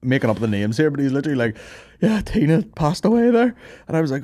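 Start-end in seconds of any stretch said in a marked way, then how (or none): 2.0-3.5 high, choked-up imitation; mock tearful. Retelling the crying customer's sad news, high and teary. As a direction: act out a man grieving. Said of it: "like, in tears".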